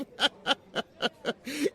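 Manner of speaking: rising teasing laugh; knowing, rising chuckle; amused laugh. Acted. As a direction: laugh at the boss. Maid laughs at employer.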